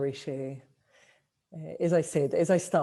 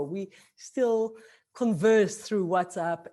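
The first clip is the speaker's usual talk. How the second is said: ordinary talk, nothing marked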